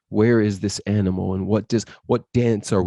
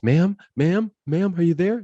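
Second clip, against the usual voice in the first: high-pitched imitation of rescuers calling; urgent checking; 10 semitones higher than usual. Doctor and nurse at her wrist, urgent high calling. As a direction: call to someone unresponsive, voice lifted high.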